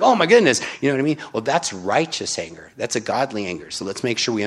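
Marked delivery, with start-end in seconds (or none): 0.0-0.6 high, loud mock gasp of shock; wide-eyed retold astonishment; shocked exclamation. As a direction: react like you just saw tables flipped. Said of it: "I'm like".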